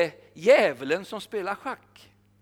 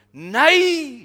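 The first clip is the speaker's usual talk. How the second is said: high, loud shouted no; shouting; 7 semitones higher, 9 dB louder than usual. Retold or imitated. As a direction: cry out across the hall. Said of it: "skriker han högt i lokalen". (högt skrik)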